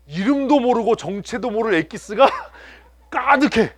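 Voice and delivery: high, loud laughing wail. Real, not acted. Laughing at fridge contents. (웃음 터지며 높고 느리게)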